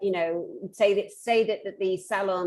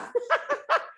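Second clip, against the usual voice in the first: high squealing laughter; laughing. Real, not acted. Laughing at her nail salon impression, high giggling. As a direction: burst out laughing at your own story.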